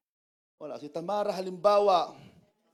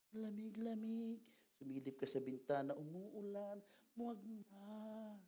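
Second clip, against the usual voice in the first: lazy tempter; 12 dB quieter than usual. Acted. The flesh tempting, voiced lazily.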